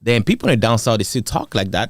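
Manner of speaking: usual speaking voice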